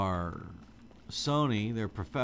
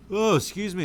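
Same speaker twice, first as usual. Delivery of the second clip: a big yawn lifting the voice much higher and louder; real yawn breaking through an apology; 12 semitones higher, 8 dB louder than usual. Real, not acted. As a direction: let the yawn pull the words up. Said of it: "I don't mean to keep yawning".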